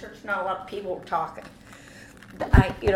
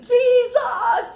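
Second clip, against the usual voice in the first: frantic high scream, very loud; 11 dB louder than usual. Retold or imitated. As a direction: scream it out like an alarm. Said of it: "I'm screaming".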